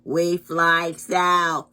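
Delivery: loud, slow goose call; geese voice. Acted. Geese announcing their journey, loud goose voice. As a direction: call it out slowly like honking geese.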